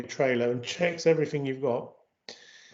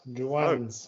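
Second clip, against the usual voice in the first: ordinary talk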